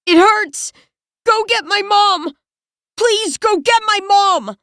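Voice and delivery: put-on high, childlike pleading cry; pleading for mom. A narrator's reading. Yang pleading for his mom, high and tearful. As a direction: beg for his mother like a small child. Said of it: "He's pleading".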